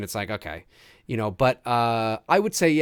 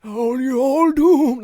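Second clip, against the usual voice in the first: slow, ominous doom-prophet voice; slow ominous raspy drawl; 11 semitones higher, 6 dB louder, much slower than usual. Retold or imitated. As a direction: warn them like a creepy old prophet. Imitating Crazy Ralph from movie.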